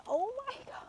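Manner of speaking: very high, soft gasp of amazement; touched surprise. Retold or imitated. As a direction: rise up high, overwhelmed by kindness. Stranger hands over ten dollars.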